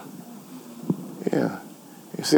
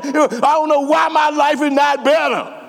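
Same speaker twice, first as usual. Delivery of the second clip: mock complainer; 7 semitones higher than usual. Mocking a gossip's complaint.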